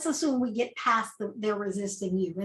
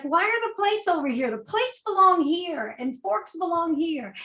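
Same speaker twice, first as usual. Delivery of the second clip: high, bossy meddling mother-in-law voice, pitched up; nagging mother-in-law; 6 semitones higher than usual. Retold or imitated. Mother-in-law scolds the cook in his own kitchen, high and bossy. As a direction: play the pushy relative correcting your cooking. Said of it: "they're like".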